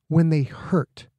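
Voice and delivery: ordinary delivery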